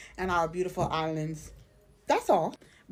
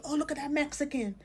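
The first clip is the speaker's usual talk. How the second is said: higher-pitched mock onlooker's remark; imitated snap judgement, pitched up; presumptuous onlooker; 6 semitones higher than usual. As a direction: lightly act out someone pointing out a stranger. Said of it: "you automatically say".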